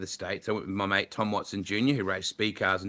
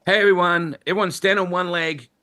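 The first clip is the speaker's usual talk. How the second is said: loud, high announcer whipping up the crowd; mock hype-man call to the crowd; hyped American crowd-pumping; 8 semitones higher, 5 dB louder than usual. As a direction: pump up the fans like a US track announcer. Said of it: "It's like".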